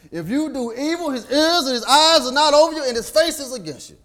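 high, loud warning cry; preaching at full force. Acted. Preacher warning evildoers, loud and high. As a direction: warn the sinners hard.

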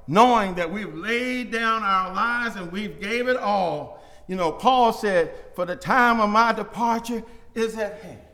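fervent closing exhortation; preaching climax. Acted. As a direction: lift the church with each line. Closing exhortation, preaching at full force.

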